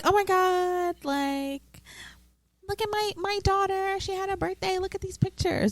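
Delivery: high, soft gushing mom showing photos; proud coworker. Retold or imitated. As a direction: gush over the daughter's birthday.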